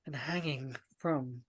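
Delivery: slow narration with long suspenseful pauses; ominous scene setting. Acted. Game master revealing blood on the stage, ominous. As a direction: let each horror land slowly, softening on the drips.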